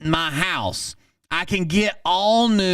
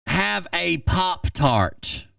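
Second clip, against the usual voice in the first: drawn out, deadpan: Have a Pop-Tart; slow deadpan; 3 semitones higher, much slower than usual. Retold or imitated. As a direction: let the Pop-Tart line land slowly in disbelief. Reading his absurd line.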